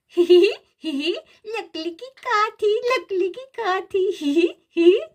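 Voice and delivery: high giggles between sung words; giggling baby. Acted. Baby sister giggles as brother plays horse. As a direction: giggle and hum along like a toddler.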